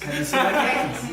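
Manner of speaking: laughing Boston accent, khakis like car keys. Shown as a demonstration. Band member repeating the Boston joke, laughing Boston accent. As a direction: chuckle through a Boston khakis. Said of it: "in Bostonian accent".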